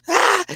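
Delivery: sudden high shriek of alarm; startled yelp. Real, not acted. Startled by the live circuit, a high yelp. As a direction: yelp like something just zapped you.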